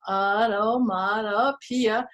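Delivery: "automatopoeia" slow, syllable by syllable; slow, careful "automatopoeia"; sounding out slowly. Shown as a demonstration. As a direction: draw out "automatopoeia" for learners. Sounds out the big word.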